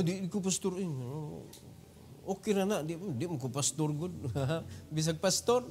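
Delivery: quiet self-deprecating aside after the exhortation. Real, not acted. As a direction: drop back into a muttered joke.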